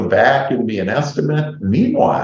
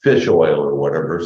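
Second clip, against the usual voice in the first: ordinary delivery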